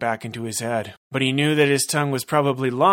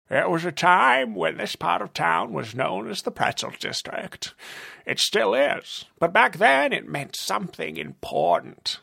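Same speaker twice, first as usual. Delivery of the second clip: creepy villain; 7 semitones higher than usual. Acted. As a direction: relish every word like a creep. Villain reminisces.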